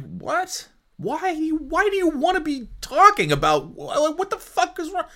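high, incredulous squeak; baffled disbelief. Retold or imitated. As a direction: act stunned and confused. Retelling own baffled reaction.

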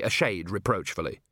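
plain reading voice

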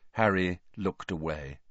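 ordinary delivery

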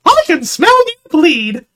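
high, slow, eerie taunt; creepy game taunt. Retold or imitated. Chasing a player in the game, slow high creepy voice. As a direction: stalk him with a creepy sing-song. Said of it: "And I was going around".

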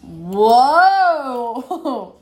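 excited whoa. Real, not acted. Impressed by piping skill.

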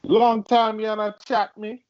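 high, slow Jamaican patois; teasing Jamaican singsong; imitated Jamaican accent. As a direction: mimic a Jamaican woman's sampled line. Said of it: "her voice and that accent".

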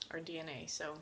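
normal talking voice

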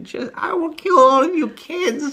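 high, loud hammed-up threat; mock raging threat. [name] shaking his fist at the kids, mock fury. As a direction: imitate a man yelling at pesky kids.

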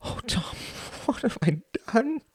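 high, breath-filled despairing plea; anguished. Acted. Distressed man to his friend Tom, anguished and breathy.

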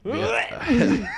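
high squeal of laughter on the yes; a yes that breaks into laughter; laughing yes. Real, not acted. Answering a friend while laughing.

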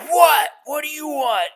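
high, loud startled snap; irritated shout. Retold or imitated. Angry man yells at the stranger knocking on his window, irritated shout. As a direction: bark it at whoever is bothering you.

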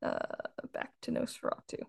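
whispered, conspiratorial; hushed restart. Pretend. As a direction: whisper it like a secret intro. Mock secret podcast restart.

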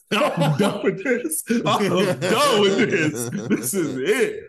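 slow, high, laughing; laughing quit. Retold or imitated. Retelling quitting after a hard hit, laughing. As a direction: laugh through the words of giving up.

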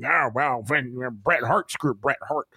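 low, muffled mock impression; Vince. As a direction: lightly imitate a boss's quiet excuse. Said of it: "Vince had to throw himself in there".

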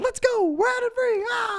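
high, loud cheer; rally the gang in a squeaky voice; mock war cry, high and loud; hammed-up whoop. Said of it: "So it's like".

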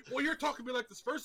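high, fast imitation of an upset friend; mock defensive. Friend retelling a spat over sincere help, mock defensive. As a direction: imitate a squabbler throwing blame back. Retold quarrel between friends.

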